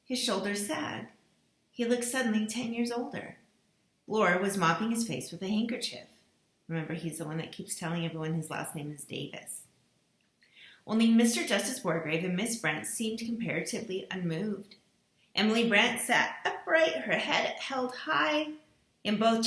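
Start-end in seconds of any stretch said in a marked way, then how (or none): none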